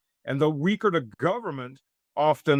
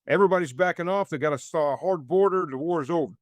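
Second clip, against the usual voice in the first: ordinary talk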